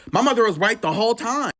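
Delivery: high, loud insistence; emphatic outburst. Real, not acted. Narrator admitting her mother was right, high and loud. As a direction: burst out with it.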